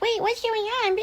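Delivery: very high, squeaky child imitation; whining kids. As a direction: squeak it like a confused little kid. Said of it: "his kids are like".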